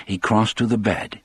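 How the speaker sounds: usual speaking voice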